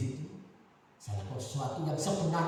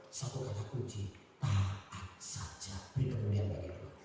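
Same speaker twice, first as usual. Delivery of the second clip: normal talking voice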